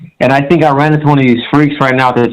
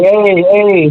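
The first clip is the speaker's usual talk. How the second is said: higher-pitched mock calling out; retold warning; 7 semitones higher, slower than usual. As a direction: re-enact telling someone to back off, pitch raised. Retells warning the kid off.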